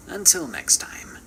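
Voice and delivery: eerie whispered farewell; creepy whisper. Acted. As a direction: whisper it like a ghost story host. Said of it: "and stay creepy".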